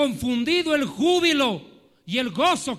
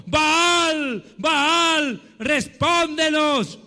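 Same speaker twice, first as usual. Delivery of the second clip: raised, drawn-out pleading call; calling on Baal; 3 semitones higher, 3 dB louder, much slower than usual. Acted. Preacher acting Baal's prophets begging an answer, raised call. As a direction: cry out to a god, holding each vowel. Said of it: "invocaron el nombre de Baal ... diciendo".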